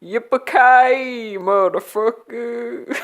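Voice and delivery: high, loud, slow cowboy catchphrase; hammed-up Western hero yelling his line; cowboy drawl. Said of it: "like a cowboy in those movies".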